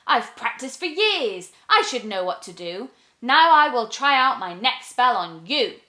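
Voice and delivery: lightly voiced, doubtful but firm witch; determined little witch. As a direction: push on despite doubt. Witch tries again.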